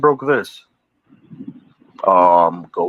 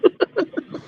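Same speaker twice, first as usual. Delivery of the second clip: high squeaky laugh. Real, not acted. Laughing at own retelling.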